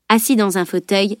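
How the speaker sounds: ordinary delivery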